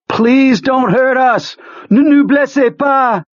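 high, drawn-out plea cried aloud; panicked cry. A narrator's reading. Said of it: "he began to cry it aloud".

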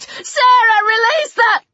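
high, crying shriek of panic. Acted. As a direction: scream the warning through tears. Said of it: "Tori shrieked".

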